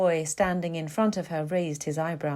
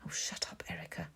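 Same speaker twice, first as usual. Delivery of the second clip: hushed self-reproach in a whisper; whispered inner thought; 14 dB quieter than usual. A narrator's reading. She curses herself for sounding trite, whispering. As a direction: whisper it to yourself as a scolding. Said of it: "then immediately thought".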